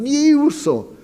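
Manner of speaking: name called high and ringing; a high call across the room. Retold or imitated. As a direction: call out to a friend who cannot hear. Calls to his weeping friend.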